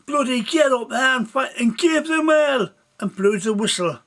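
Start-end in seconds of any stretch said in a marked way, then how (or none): 0.1-2.7 sergeant shouting. Acted. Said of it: "a voice from the sergeant in the back shouting".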